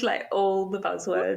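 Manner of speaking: ordinary talk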